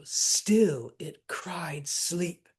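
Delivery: slow whispered "still it cried sleep", feet marked; hushed, deliberate scan; scansion whisper. Shown as a demonstration.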